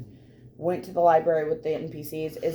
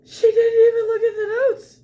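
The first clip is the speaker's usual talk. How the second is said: much higher, louder astonishment; amazed quote; 14 semitones higher, 5 dB louder than usual. Retold or imitated. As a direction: gasp it out in disbelief. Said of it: "and he goes".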